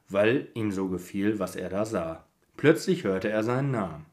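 calm storybook reading; steady, gentle storyteller reading; story narration. Acted. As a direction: read it like a bedtime story. Reads advent story aloud.

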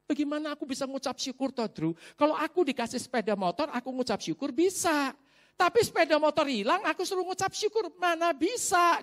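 high-pitched retold objection; indignant protest. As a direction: imitate a friend protesting in disbelief. Friend's protest voiced in story. (menirukan protes teman dengan nada tinggi)

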